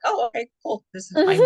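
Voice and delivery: put-on high, loud, bright little-kid chirp; toddler voice. Mimicking a toddler who is suddenly fine, high and loud. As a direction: switch instantly into a cheery toddler. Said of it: "and they're like".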